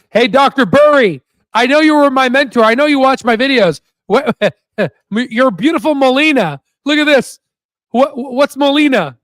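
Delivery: high, mock plea to a mentor; playful calling out. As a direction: call out high, stretching the name. Mock call-out to absent mentor.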